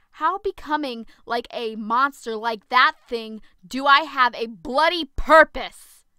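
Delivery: very loud anguished yell. Acted. Zoe arguing with Penelope about her purpose, furious yell. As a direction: shout it out in bitter despair. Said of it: "I yelled".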